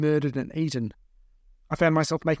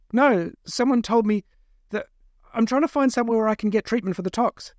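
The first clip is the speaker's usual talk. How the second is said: higher, halting plea; anxious captive; 7 semitones higher than usual. Acted. Captive justifying himself to his captors, anxious. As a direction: explain haltingly in a raised pitch.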